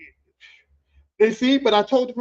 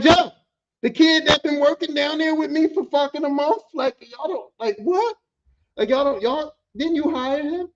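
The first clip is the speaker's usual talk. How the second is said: exasperated disbelief; 6 semitones higher, 3 dB louder than usual. Retold or imitated. Confused that nobody knows Joe.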